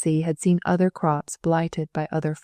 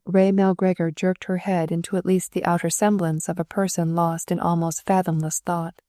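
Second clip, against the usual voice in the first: ordinary delivery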